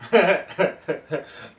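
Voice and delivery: helpless burst of laughter; real laughing fit. Real, not acted. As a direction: lose it laughing mid-thought. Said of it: "Now I'm laughing".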